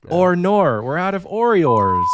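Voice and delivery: loud, exaggerated Aussie accent; hammed-up Australian, vowels bent into "or"; bad Australian accent. Demonstrating a really bad Australian accent to friends, loud. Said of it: "my Australian accent's really bad".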